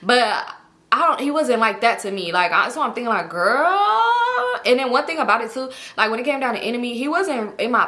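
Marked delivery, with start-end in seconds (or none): 3.3-4.6 put-on long, high, rising "girl"; drawn-out pitched-up judgement; mock disbelief. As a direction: stretch it out as an appalled reaction. Voicing her own appalled thought.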